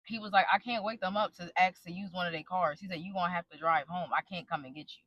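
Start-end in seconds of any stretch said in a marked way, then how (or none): 0.5-2.8 low, soft imitation of him; gone quiet. Husband refusing to wake his parents, quiet imitation. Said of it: "he gets quiet and he's like". 3.0-5.0 low, soft retold refusal; gone quiet. Husband refusing to fetch his drunk wife, quiet imitation. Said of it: "he gets quiet and he's like".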